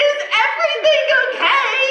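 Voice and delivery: high, bright, cheery question; retold dumb question. Retelling her awkward question to the woman, chirpy and high. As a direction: ask it brightly, sounding clueless. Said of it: "you will not say anything dumber".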